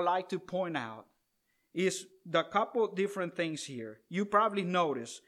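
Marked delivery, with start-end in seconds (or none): none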